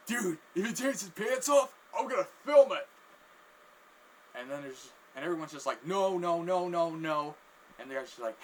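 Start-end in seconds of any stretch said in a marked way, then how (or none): 0.1-2.9 loud and high, itching to see it; acted onlooker getting carried away; excited outburst. A chef to a coworker about filming the stunt, excited outburst. Bystander eager to film it. 5.9-7.4 raised, louder string of objections; mock protest. Everyone stopping the man's stunt, mock protest.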